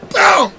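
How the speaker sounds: high, sudden boom noise; one high punch sound effect; imitated punch boom. Shown as a demonstration. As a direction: pop out a high mock impact.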